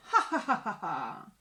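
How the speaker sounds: playground jeer of ha ha, rising then dropping low; storybook bullies' put-on laugh; mock taunting laugh. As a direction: tease him with a fake nasty laugh. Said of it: "laughed the other dragons".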